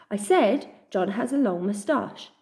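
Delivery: ordinary delivery, nothing marked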